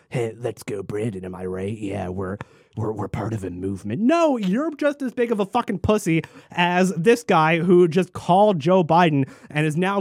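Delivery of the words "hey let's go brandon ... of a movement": low, soft smug snicker; smug mock voice. Mocking hidden online trolls, low and smug. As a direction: mutter it like a cowardly troll. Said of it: "sit behind and just go".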